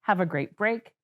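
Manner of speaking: normal talking voice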